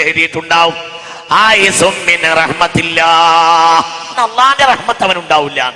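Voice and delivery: ringing sermon voice; full-force preaching. Acted. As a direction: preach it to the hall.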